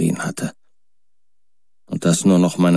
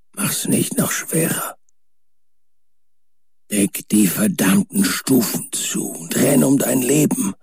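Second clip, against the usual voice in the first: faint, higher voice fading to a whisper; weak voice; 8 semitones higher, slower than usual. A narrator's reading. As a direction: say farewell as if nearly gone. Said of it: "die schwache Stimme von Warren".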